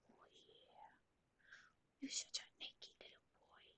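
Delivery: very soft, whispery coo; hushed pet talk. Real, not acted. Owner to a naked little boy, hushed pet talk. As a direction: murmur it fondly to a pet. Cooing privately to the dog.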